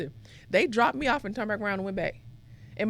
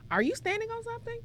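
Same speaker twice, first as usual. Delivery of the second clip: much higher, amazed coach voice; imitating the coach; 13 semitones higher than usual. Coach asking the tall girl at McDonald's, higher and amazed. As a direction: play the stunned coach at the drive-thru. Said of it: "came through and said".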